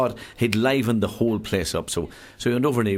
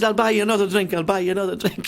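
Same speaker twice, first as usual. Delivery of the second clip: eager offer; 12 semitones higher, 3 dB louder than usual. Acted. Truck driver offers drink.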